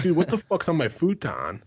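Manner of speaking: high-pitched, appalled outburst; indignant buddy. Retold or imitated. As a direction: act out a buddy upset about his furniture. Buddy discovers mess on futon.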